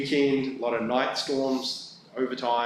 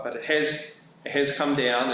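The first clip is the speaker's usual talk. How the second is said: normal talking voice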